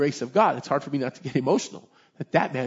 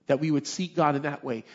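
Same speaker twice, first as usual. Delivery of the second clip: ordinary talk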